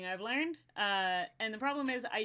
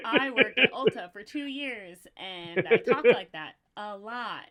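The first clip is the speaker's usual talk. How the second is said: put-on louder bright Valley Girl customer voice; perky retail voice; 12 dB louder than usual. Shown as a demonstration. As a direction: chirp it like a beauty store clerk. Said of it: "You sound like the color pink".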